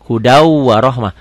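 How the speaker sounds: "Hudau warohmah" with a held, doubled w; idgham reading. Shown as a demonstration. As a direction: linger on the w joining "Hudau" to "warohmah". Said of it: "ada tasdidnya". ("Hudau warohmah", w bertasydid dan ditahan)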